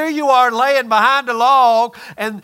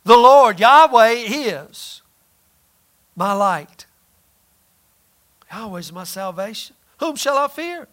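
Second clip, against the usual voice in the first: proclaimed then hushed. Acted.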